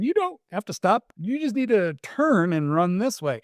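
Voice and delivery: high coaxing voice to a dog. Acted. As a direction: sweetly coax the husky to turn. Coaching the running husky.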